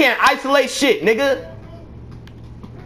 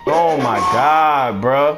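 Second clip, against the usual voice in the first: low, drawn-out despair; weary, sinking disbelief; exasperated groan; 10 semitones lower, slower than usual. Real, not acted. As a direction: drop low and slow, fed up with a teammate. Despairing at teammate's play.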